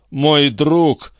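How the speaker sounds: high, moaning lament; moaned. Acted. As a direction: groan it for a fallen friend. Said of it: "простонал Трегумен". (жалобный стон повыше)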